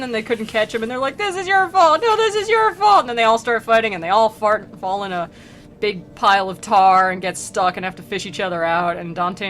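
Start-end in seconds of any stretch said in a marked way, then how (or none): 1.2-3.0 high, loud blaming shout; squabbling demons. Retold or imitated. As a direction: bicker like idiots pinning the blame. Demons blame each other.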